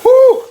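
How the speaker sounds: shrill whoop from the burn; high loud pained whoop. Real, not acted. Burning mouth, whoop.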